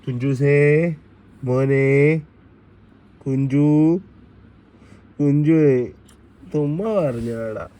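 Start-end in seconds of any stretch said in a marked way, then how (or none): 0.0-5.8 calling out. Real, not acted. Calling the sulking child by name.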